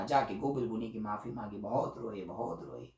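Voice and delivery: usual speaking voice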